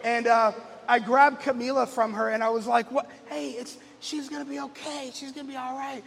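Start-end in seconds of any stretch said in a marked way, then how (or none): 2.9-6.0 put-on soft, hushed reassurance to a crying girl; calm her down gently; soothing dad.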